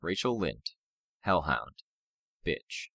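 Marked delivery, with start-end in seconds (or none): none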